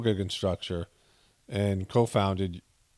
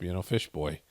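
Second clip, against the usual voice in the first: ordinary delivery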